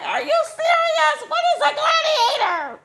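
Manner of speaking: laughing disbelief. Retold or imitated. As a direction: shriek in amused disbelief. Disbelief at gladiator news.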